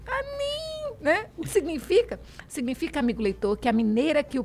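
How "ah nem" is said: much higher, softer whining "Ah, nem"; soft reluctant refusal; mineiro "ah, nem". Shown as a demonstration. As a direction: whine "Ah, nem" up high. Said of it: "Já ouviu o nem?".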